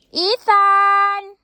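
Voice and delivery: shouting a name across the garden; calling out. Real, not acted. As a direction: yell for Ethan from afar.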